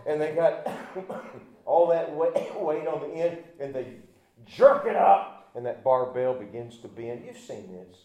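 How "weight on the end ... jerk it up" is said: normal talking voice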